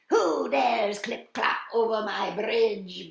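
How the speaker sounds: loud, slow, booming challenge; indignant storybook troll bellow. A narrator's reading. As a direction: bellow it, dragging each word. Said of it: "bellowed the troll".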